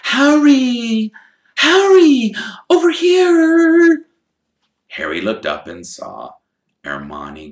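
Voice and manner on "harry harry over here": put-on high, loud girlish call, slow at the end. Hermione calling Harry from the Gringotts steps, high and loud. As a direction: wave him over from afar.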